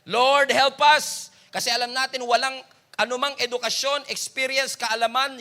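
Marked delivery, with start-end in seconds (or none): 0.0-1.3 very slow, loud, drawn-out plea; crying out to God. Retold or imitated. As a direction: stretch every word in a pleading prayer. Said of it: "we are crying out the same thing".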